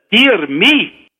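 a hint of the Scottish professor; Scottish accent. Examiner looks at his watch, mild Scottish accent. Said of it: "try to do my Scottish accent".

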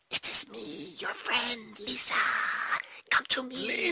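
high breathy ghostly whisper; creepy voice. Retold or imitated. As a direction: whisper it high like a spooky ghoul. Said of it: "in a creepy voice".

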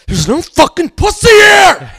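high, loud, drawn-out mock scream; screaming friend. Frustrated friend at a bar during the game, screaming. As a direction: imitate a buddy yelling in desperate outrage. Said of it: "Anybody who screams".

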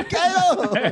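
high, drawn-out excited cry through a laugh. Retold or imitated. Reliving the excitement of seeing Kano, excited. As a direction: relive the fan's thrill, laughing. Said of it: "I was so excited".

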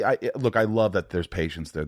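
normal talking voice

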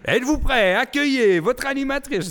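annonceur enthousiaste, plus aigu et plus fort; voix d'annonceur de spectacle. Retold or imitated. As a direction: lance le show comme un animateur. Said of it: "C'est moi qui est genre Denis Fortin". (voix d'annonceur de spectacle qui présente l'animatrice)

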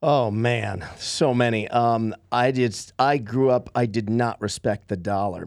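ordinary talk, nothing marked

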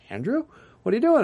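shocked, high what are you doing; startled to bump into an old colleague; surprised greeting. Retold or imitated. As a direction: pitch jumps way up on Andrew. Surprised to meet friend.

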